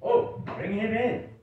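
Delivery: a king waving the guest in; king's reply. Acted. King receiving news of visitor.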